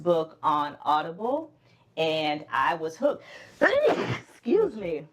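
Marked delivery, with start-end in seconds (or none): 3.6-4.2 a real sudden sneeze; sharp involuntary sneeze burst; sneezing. Real, not acted. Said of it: "excuse the sneezing".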